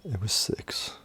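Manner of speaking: whispered reveal, no voice. Real, not acted. Host whispers the real body count to the co-host. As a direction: breathe the answer out softly.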